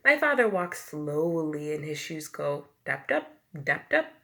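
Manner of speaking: ordinary delivery, nothing marked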